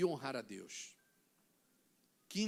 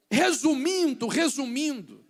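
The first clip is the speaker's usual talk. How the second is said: loud, higher summing-up; preaching emphasis; 4 semitones higher, 10 dB louder than usual. Real, not acted. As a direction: call it out to the church. Pregação. (ênfase de pregador, forte)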